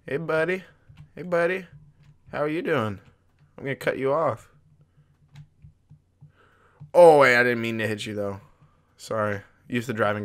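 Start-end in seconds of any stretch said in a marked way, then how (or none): 0.1-8.1 calling out. Acted. Greeting another truck on road.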